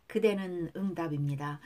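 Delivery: ordinary talk, nothing marked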